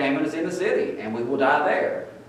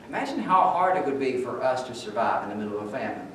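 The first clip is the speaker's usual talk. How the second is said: ordinary talk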